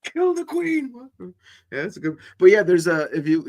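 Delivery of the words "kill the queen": high-pitched battle cry; rally the crowd in fun; movie quote yell. Retold or imitated. Quoting the film, a high battle cry.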